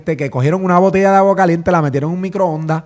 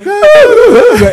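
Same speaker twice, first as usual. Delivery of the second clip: high shrieking laugh; 9 dB louder than usual. Real, not acted. Bursts out laughing at himself.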